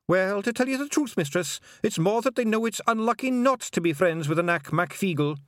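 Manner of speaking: cheeky wee Scots pixie; Scottish pixie. A narrator's reading. Feegle pixie voiced in story.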